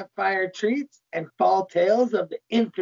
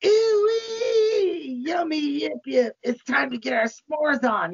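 high, whooping excited squeal; squealing delight, settling as it goes; giddy glee; 7 semitones higher than usual. Acted. Smiling Satan celebrates s'mores in story.

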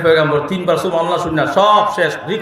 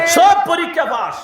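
rousing shout; 13 semitones higher than usual. Real, not acted. Preacher to congregation, shouting the point home. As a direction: fire up the congregation.

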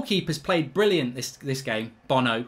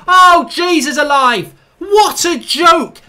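bitter laughing yell, slow and loud; outraged shout; 6 semitones higher, 6 dB louder, slower than usual. Real, not acted. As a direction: shout your disbelief.

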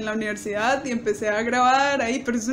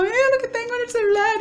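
much higher, fast, thrilled; excited; 11 semitones higher, faster than usual. Retold or imitated. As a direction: rush it out, bursting to show your brother. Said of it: "todo emocionado".

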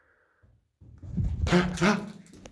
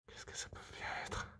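hushed uneasy whisper; voiceless wondering whisper; 23 dB quieter than usual. Real, not acted. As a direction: whisper it so nothing hears. Hushed in a dark house.

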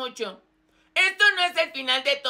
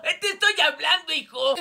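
normal talking voice

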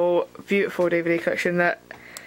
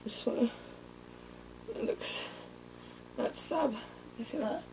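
whisper; 15 dB quieter than usual. Real, not acted. Muttering aside, very quiet.

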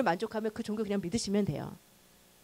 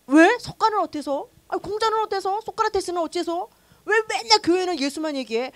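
higher, mocking challenge; defiant challenge; 6 semitones higher than usual. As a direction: needle the church scornfully. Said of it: "막 제가 그랬다니까요". (예수만 얘기하냐며 비꼬듯 따지기)

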